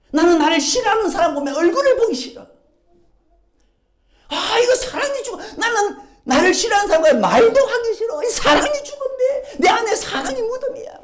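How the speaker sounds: loud, high, impassioned sermon; fervent preaching. Acted. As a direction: proclaim it to the whole church. Preaching at full force.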